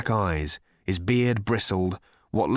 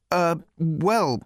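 a hesitant stammering Arthur, pitched up; stuttering; 6 semitones higher than usual. A narrator's reading. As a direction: stumble over the words, unsure. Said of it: "stuttered Arthur".

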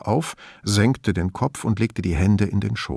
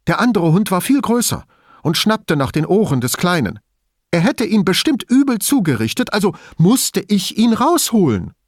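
high, insistent self-justification; Elisabetta, agitated and defensive; 7 semitones higher, 3 dB louder than usual. A narrator's reading. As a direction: plead your innocence with rising agitation. Narrator voices Elisabetta justifying herself.

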